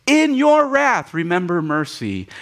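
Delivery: high, loud, slow plea; Habakkuk pleading at the top of his voice; fervent prayer. Acted. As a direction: cry it out to God.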